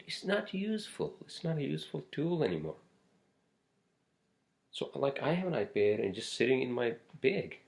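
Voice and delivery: high-pitched, fed-up complaint; rising frustration, voice pushed up high; exasperated rant. Real, not acted. Ranting that the iPad is useless.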